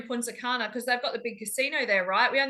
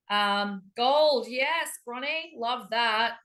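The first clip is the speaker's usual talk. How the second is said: ordinary talk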